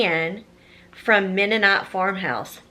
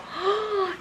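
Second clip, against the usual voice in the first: delighted gasp; 11 semitones higher, 3 dB quieter than usual. Real, not acted. Seeing the chicken apron.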